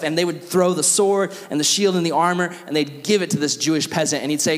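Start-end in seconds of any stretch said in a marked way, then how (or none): none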